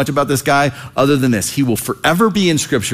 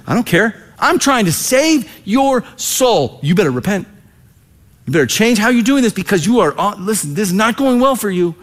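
higher, urgent pleading warning; insistent raised warning; 7 semitones higher than usual. Acted. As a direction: press it on them, urgent and raised.

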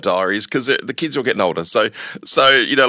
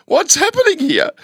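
put-on confused girlfriend. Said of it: "she's like".

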